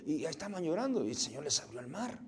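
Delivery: ordinary talk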